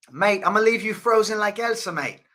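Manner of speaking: high, put-on New Zealand accent; attempted New Zealand accent. Streamer imitating the fighter's threat, attempted New Zealand accent. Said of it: "they don't say mate in New Zealand".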